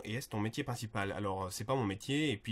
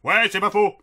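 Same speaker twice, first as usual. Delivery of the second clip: loud, high-pitched catchphrase; Kaamelott character; 8 semitones higher, 14 dB louder than usual. Acted. Replying with a catchphrase, loud Kaamelott character voice. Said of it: "imiter des personnages de Kaamelott".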